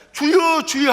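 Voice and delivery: high, loud "주여" for all to repeat; loud call to the Lord. Shown as a demonstration. Preacher leading the church in calling out. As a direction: lead the call, then let it fall. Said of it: "이것이 훈련이 돼야 한다니까요".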